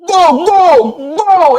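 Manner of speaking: very high, loud yell; hammed-up shriek of pain; pained scream. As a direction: scream as the needle stabs you.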